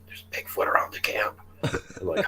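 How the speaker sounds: imitated hushed whisper; secretive voiceless warning; urgent whisper. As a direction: whisper it softly as if waking a tentmate. Woman waking him at night.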